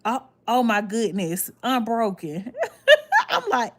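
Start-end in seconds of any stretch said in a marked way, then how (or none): 2.6-3.2 sudden loud high-pitched laugh; burst of high laughter. Real, not acted. Streamer reading a viewer's cheeky comment, bursting out laughing. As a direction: crack up at the chat comment.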